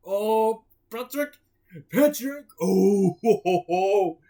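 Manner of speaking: high, loud dopey starfish voice; dopey Patrick with a big laugh. Acted. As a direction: call out goofy and delighted.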